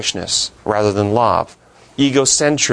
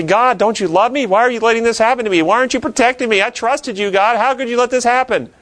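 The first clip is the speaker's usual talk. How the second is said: much higher, hurt complaint to God; teacher voicing a believer's hurt prayer, much higher; pleading with God; 11 semitones higher than usual. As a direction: plead like someone who feels betrayed. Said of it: "we say".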